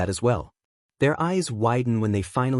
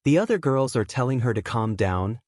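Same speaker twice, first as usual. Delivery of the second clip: usual speaking voice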